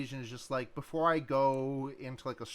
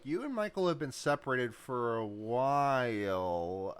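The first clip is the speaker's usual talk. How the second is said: quoting Girder; 4 semitones lower, slower than usual. Retold or imitated. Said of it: "Girder, is like".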